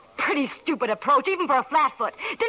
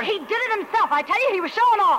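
defensive protest; 9 semitones higher, 3 dB louder, faster than usual. Acted. Quarrel on the roller coaster.

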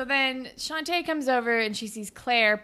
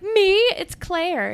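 high, loud startled question; acted surprise; 9 semitones higher, 7 dB louder, slower than usual. Claire answers the boy at the Dot, acted surprise. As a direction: imitate a flattered, startled girl.

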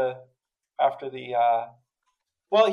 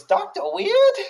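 put-on much higher, louder small boy asking Doctor Weird?; imitating his kid; 7 dB louder, slower than usual. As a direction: squeak it like a little kid. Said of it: "Does he talk like that".

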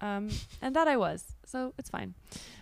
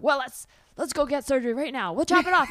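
overeager fixer; 5 semitones higher, 4 dB louder than usual. Retold or imitated. Overeager fixer voiced in example.

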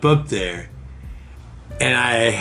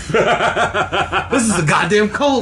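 high, hammed-up preacher voice; laughing mock sermon about bulk buying; mock cult preacher; 10 semitones higher than usual.

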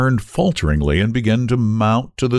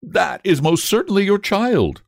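higher, emphatic reproof; severe; 10 semitones higher than usual. A narrator's reading. Nurse confirms the child is his, severely. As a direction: insist sternly, pitch rising. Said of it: "replied the nurse severely".

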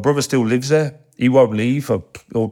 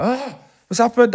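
high-pitched imitation of a startled mum; 13 semitones higher than usual. Mum answering her son's late-night phone call, startled. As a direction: play mum's surprised question, pitched right up.

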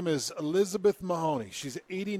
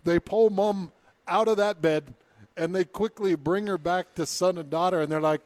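ordinary talk, nothing marked

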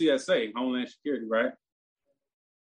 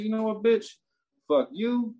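higher pitched mock cursing out; retold angry outburst; 7 semitones higher than usual. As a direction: spit it at her. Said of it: "That's how fucking mad I was".

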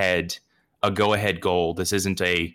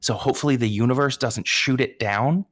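usual speaking voice

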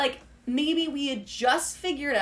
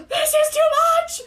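high, slow overwhelmed cry; high cry of being overwhelmed; 7 dB louder, slower than usual. Retold or imitated. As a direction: squeal it like it's too much. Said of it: "I was like".

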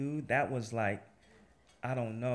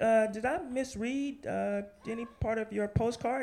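high, hesitant and timid; timid question; 10 semitones higher than usual. Retold or imitated. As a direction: tiptoe around the question. Nervous call to editor.

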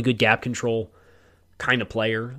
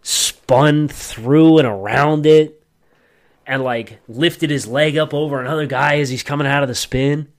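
slow, loud, drawn-out awe; excited description; 4 semitones higher, 8 dB louder, slower than usual. Real, not acted. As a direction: stretch it out in amazement. Describing a spin move in awe.

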